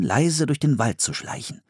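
ordinary delivery

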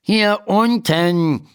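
little doctor snapping from the floor; annoyed. A narrator's reading. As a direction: say it put out and huffy. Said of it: "sagt die Stimme verärgert".

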